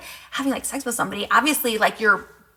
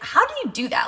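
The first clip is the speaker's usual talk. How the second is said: much higher, wide-eyed amazement; amazed question; 11 semitones higher than usual. Retold or imitated. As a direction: ask it like you can't believe what just happened. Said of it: "And I was just like".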